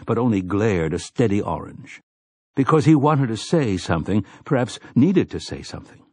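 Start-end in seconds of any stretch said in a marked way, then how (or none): none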